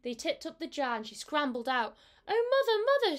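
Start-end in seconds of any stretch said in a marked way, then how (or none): 2.3-3.2 very high, loud kitten cry; frightened kitten. A narrator's reading. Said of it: "said Mittens".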